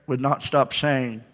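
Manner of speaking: ordinary delivery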